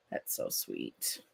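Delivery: very soft, low and slow; hushed, touched response; tender sympathy. Real, not acted. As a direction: murmur it tenderly, almost to yourself. Touched by a bereaved viewer's tribute.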